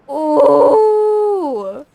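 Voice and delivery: loud, awed exclamation; amazed gasp. Real, not acted. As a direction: react to something huge. Sees the finished aviary.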